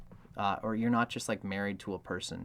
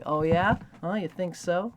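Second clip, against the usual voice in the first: higher, louder mock-daring retort; a partner teasing back in fun; playful challenge; 9 semitones higher, 8 dB louder than usual. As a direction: throw down a playful dare. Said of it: "play with that".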